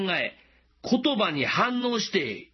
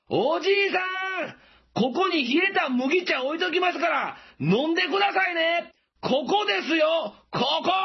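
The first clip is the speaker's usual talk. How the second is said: shouting; 11 semitones higher than usual. Retold or imitated. Passerby shouting about cold barley tea to a deaf farmer, very high shout. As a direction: yell so a hard-of-hearing farmer finally hears. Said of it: "もっとでっかい声で叫びました".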